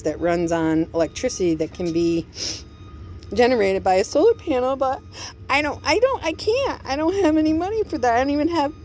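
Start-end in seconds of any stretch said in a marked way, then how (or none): 5.5-8.7 real upset, voice climbing high; distressed. Real, not acted. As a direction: voice jumps much higher in distress. Breaking down over money.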